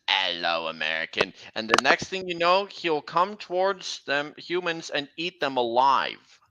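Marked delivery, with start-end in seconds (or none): 0.1-1.3 very low, deep monster greeting; killer train car's catchphrase. Acted. Possessed car greets its prey, deep menacing voice. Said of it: "he says".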